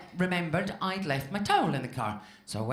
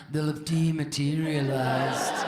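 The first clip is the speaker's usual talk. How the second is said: Steve impression; 6 semitones lower, slower than usual. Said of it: "Fucking Steve wasn't being any help".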